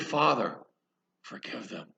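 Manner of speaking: hushed, fading to a whisper; soft prayer. Acted. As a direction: breathe it out gently, forgiving. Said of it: "But he said".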